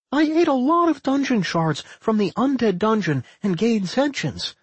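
put-on high-pitched slime girl bragging; high, self-important brag; boastful. A narrator's reading. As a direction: show off in a high, girlish voice. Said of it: "Anodyne boasted".

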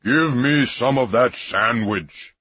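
majestic voice of God. Retold or imitated. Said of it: "the voice got magnificent and holy".

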